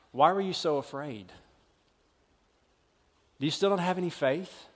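soft, low question from Jesus; Jesus gently asking. Retold or imitated. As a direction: ask it quietly, almost under the breath. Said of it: "He looks at them and He says".

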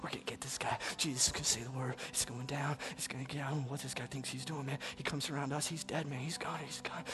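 the crowd grumbling under their breath; soft, hurried murmur among the stone-throwers. Acted. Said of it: "murmuring to himself".

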